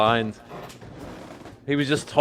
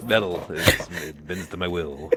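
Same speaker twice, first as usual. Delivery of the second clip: deep, halting villain voice; 8 semitones lower, 3 dB quieter than usual. Acted. Magneto botching his one line, low villain voice. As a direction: fumble the catchphrase low and deadpan. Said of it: "That's all he just keeps saying".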